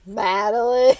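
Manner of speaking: loud mock scolding of the name; imitating the stern name call. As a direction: echo her name the way she said it. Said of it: "You said my name so".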